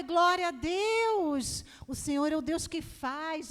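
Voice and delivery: loud, drawn-out church exclamation, easing off; shout of praise. Retold or imitated. Mother praising God at the good news, exultant shout. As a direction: cry out praise, stretching the first phrase. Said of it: "Eu dei um glória a Deus".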